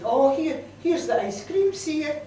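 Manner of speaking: high, loud call to the others; excited shout. A narrator's reading. Someone shouts to children playing that the ice cream is here, excited shout. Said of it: "Then somebody shouted".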